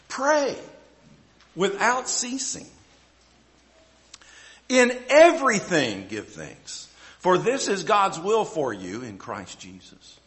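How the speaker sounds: slow, weighty reading of the verse; preacher reading scripture to the church, slow and solemn. Acted. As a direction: lay each word down for the congregation.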